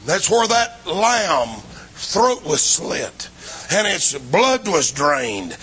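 ordinary talk

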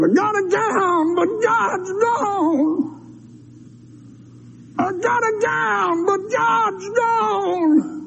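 high, wailing cry, drawn out slowly; crying lament. Retold or imitated. Girl crying in the road at night, high wailing cry. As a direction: wail it like a heartbroken girl who lost God. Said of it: "And she's crying".

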